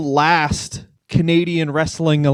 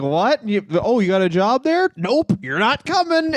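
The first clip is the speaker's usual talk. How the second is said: higher, incredulous then flat refusal; mock partner; 6 semitones higher than usual. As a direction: play the shocked spouse slamming the door. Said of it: "your partner's like".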